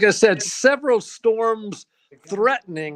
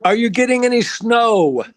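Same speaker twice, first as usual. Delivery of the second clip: loud, higher, raised to carry; calling out loud; 4 semitones higher, 7 dB louder than usual. Real, not acted. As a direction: raise your voice so she hears. Repeating question over bad audio.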